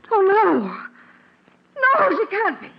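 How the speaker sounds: high, loud sob of disbelief; shocked cry. Acted. Mother hears her daughter is dead, shocked sob. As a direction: break down at the news.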